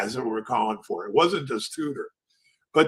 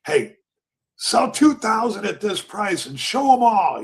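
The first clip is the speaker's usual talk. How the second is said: raised, higher-pitched trading order; imitated order call; 7 semitones higher, 3 dB louder than usual. Tudor orders his clerk to sell, imitated raised call. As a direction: call out the order, mildly hammed-up.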